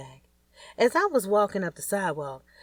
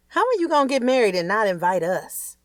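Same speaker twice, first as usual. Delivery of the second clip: indignant mom; 6 semitones higher, 6 dB louder than usual. Acted. Mom upset at secret wedding.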